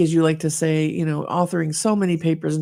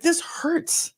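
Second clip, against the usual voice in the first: high, pained insistence; insistent complaint; 11 semitones higher than usual. Retold or imitated. Mom telling daughter, high and pained. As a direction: insist it really hurts. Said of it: "I'm like".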